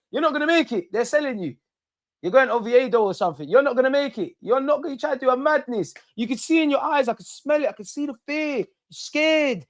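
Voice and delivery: high, gloating jeers at the player; high mocking taunt. Real, not acted. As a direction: rub it in, taunting the taker who missed. Taunting the opposing penalty taker.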